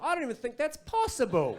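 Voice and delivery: high-pitched, hammed-up doubt; mock disbelief. Colleague doubting the host in a meeting, high mock disbelief. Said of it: "then someone else goes".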